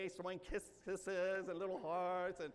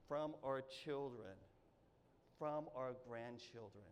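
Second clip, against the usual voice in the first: sincere; 7 semitones lower, 6 dB quieter, slower than usual. Real, not acted. Heartfelt slow sermon passage.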